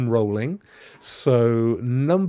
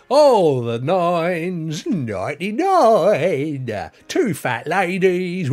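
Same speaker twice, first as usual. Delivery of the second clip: drawn-out, louder bingo call; bingo caller; 7 semitones higher, 8 dB louder, much slower than usual. Pretend. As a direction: call the numbers like a bingo hall. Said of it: "been good at it as a, as a bingo caller".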